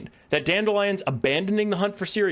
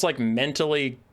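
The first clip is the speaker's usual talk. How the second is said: ordinary delivery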